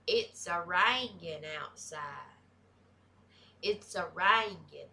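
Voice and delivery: imitating grandma's thick country accent, "rangin'" for raining. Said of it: "that's a thick country accent".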